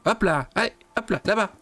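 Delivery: high-pitched calls to turn round; urging an animal on. Real, not acted. Player steering a stubborn creature home, high urging voice. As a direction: coax a wayward mount with high, insistent calls.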